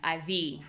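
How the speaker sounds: "I V" stressed on the V; short "I", held "V" in "I V"; second-syllable stress. Shown as a demonstration. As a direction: lean on the V of "I V". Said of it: "only thing that changes is the stress".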